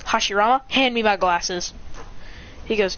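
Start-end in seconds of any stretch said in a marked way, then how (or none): none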